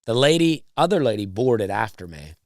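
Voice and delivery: ordinary delivery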